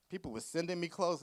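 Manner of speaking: ordinary delivery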